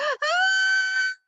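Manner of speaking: high shrieking wail; a sudden high piercing cry from below; loud shrieking gasp; mock wail. Mother wails coming up stairs.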